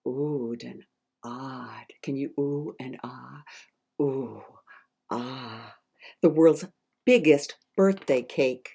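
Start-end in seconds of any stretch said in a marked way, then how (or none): none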